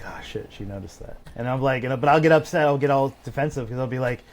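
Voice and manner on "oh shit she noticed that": low, soft dismayed aside under the breath; caught-out murmur. Retold or imitated. Husband caught out by his wife's remark, dismayed murmur. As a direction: mutter it like you just got caught. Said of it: "And I was like".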